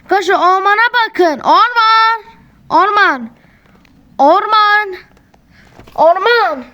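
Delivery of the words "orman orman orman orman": sing-song call to the goat. Real, not acted. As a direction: call the animal over by its name. Calling the goat by name.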